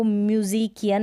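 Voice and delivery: "म्यूजिकियन" with a hard k; wrong k pronunciation. Shown as a demonstration. Teacher shows how not to read musician, wrong k pronunciation. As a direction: say musician as "म्यूजिकियन", myu-zi-ki-yan. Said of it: "नहीं पढ़ते हैं".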